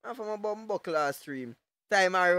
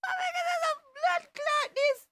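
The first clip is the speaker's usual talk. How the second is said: much higher, a squeaky disbelieving cry; high shocked squeal. Real, not acted. Streamer stunned by the man's look, high squeal.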